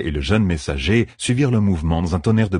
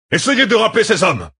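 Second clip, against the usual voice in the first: high, forceful general's command; shouted order; 4 dB louder than usual. A narrator's reading. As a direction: shout the order across the battlefield. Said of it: "ordonna le général". (ordre crié, voix haute et pressante)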